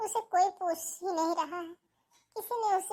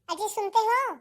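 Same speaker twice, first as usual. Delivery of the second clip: raised, higher-pitched call; calling out loudly; 4 semitones higher, 6 dB louder than usual. Acted. Wife calling her husband to come, shouting. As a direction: shout for someone out of sight. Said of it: "क्यों चिल्ला रही हो".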